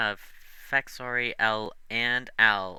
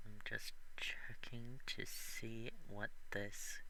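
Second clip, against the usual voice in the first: whispering; 18 dB quieter, slower than usual. Real, not acted. GM mutters while checking rules.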